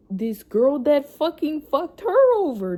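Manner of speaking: real flare of anger on the swearing; heated outburst. Real, not acted. Venting about the girl who used her friend, heated outburst.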